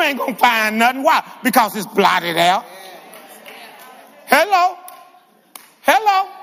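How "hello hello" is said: high, prodding call to the congregation; calling for response. Real, not acted. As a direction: prod a silent crowd with a raised pitch. Calls out to unresponsive congregation.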